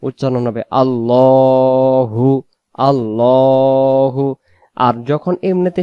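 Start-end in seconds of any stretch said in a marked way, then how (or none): 0.8-4.2 আল্লাহু drawn out slow, lam full and heavy; slow recitation of আল্লাহু, heavy lam. Shown as a demonstration. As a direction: stretch আল্লাহু with a thick dark l. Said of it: "লামটাকে মোটা করে পড়তে হয়".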